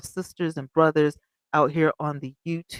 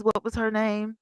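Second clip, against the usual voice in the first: normal talking voice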